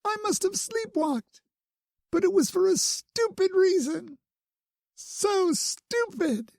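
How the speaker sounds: put-on high, upset girlish rush; berating herself through tears; tearful rant. A narrator's reading. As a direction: Bloom upset while tending him. Bloom crying, blaming herself.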